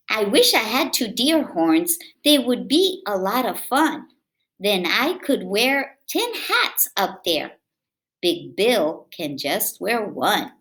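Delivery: put-on lively read-aloud for kids; storybook reading. A narrator's reading. Reading a picture book aloud to children, lively.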